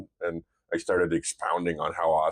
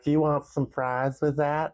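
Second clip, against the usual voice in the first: much higher, slow Southern drive-thru drawl; Southern accent; 9 semitones higher than usual. Retold or imitated. Dad imitating a Southern drive-thru worker in a Southern accent. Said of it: "a gal there from the South".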